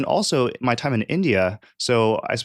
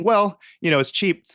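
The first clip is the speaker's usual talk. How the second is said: dismissive shrug; 10 semitones higher than usual. Retold or imitated. Recalling a Beijing market visit, high dismissive shrug. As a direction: shrug it off as cheap junk. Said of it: "and thinking".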